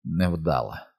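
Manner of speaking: understated regret; quietly rueful; regretful. A narrator's reading. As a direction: say it with a touch of regret. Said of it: "а тоді з жалем сказав".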